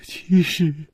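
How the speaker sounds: halting words between sobs; weeping bitterly. A narrator's reading. Liu Wumei begins to explain, weeping bitterly. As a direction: start to confess, choked with crying. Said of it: "痛哭着说".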